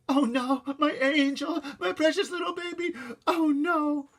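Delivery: high, loud wailing mother; moaning. A narrator's reading. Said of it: "My mother moaned".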